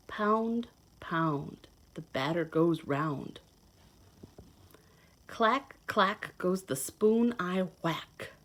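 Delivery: lively storybook read-aloud; reading to children. Acted. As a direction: read it like a picture book to little kids. Reads picture book to children.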